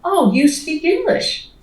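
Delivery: high, slow patronizing "Oh, you speak English"; imitating a clueless stranger's surprise; mock surprised stranger. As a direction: mimic the condescending compliment. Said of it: "a comment that says".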